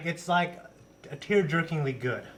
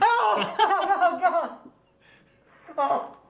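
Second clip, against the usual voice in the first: laughing exclamation; 13 semitones higher, 3 dB louder, much slower than usual. Real, not acted. Laughing reaction to redemption.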